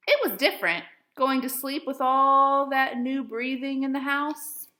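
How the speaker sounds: gentle storybook reading voice; expressive picture-book read-aloud; reading to children. Acted. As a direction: read it like story time for young kids. Teacher reads picture book aloud.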